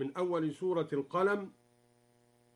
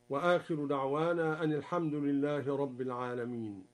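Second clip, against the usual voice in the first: ordinary delivery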